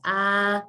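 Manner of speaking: long, held "ah" with relaxed tongue; "ah" as for a doctor's throat check; open ah. Shown as a demonstration. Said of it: "like your doctor is actually asking".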